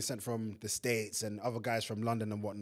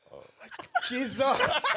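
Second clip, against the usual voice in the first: laughing shock; 12 semitones higher, 5 dB louder, much slower than usual. Retold or imitated. Retold shock at baby.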